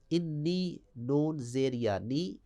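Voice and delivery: ordinary talk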